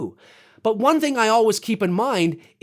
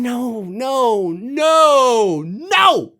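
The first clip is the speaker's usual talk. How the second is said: drawn-out refusal rising higher and louder; escalating exasperation; 4 semitones higher, 4 dB louder, much slower than usual. Real, not acted. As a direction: build each "no" into an exasperated cry. Rejecting the mocked stereotype, building.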